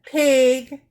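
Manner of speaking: loud accusing exclamation; insulting. Acted. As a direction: spit out the insult. Acted insult at breakfast.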